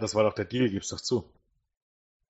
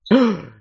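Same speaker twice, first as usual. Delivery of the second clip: surprised gasp; 6 semitones higher, 10 dB louder than usual. Real, not acted.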